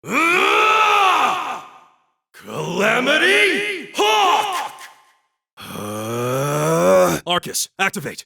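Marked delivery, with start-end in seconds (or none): 0.0-4.7 high, slow drawn-out attack yell; stretched high battle cry; special move shout. Acted. 5.6-7.2 pained grunt. Acted. Hit in battle.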